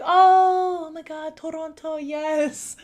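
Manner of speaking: put-on high, delighted Korean-accented mom; mock a mom's loud "oh" of recognition in Korean accent; high Korean-accented exclamation; imitated Korean mom. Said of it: "switch the accent".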